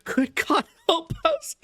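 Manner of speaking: high, desperate cry to God; frantic plea. Acted. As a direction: beg wildly for help. Harker begging wildly.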